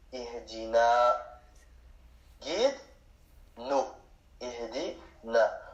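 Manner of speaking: Ihdina and Guide-nous stretched out very slowly; slow drill. Shown as a demonstration. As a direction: drill the word and its meaning slowly for the class. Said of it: "teacher drills word and translation".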